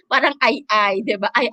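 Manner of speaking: ordinary talk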